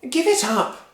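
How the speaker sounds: high, loud scolding wife; Missus Cranky nagging. Acted. Missus Cranky scolding husband, high nagging voice. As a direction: nag him, sure he'll fail. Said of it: "Missus Cranky said".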